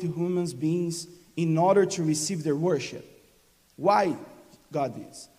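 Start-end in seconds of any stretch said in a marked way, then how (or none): none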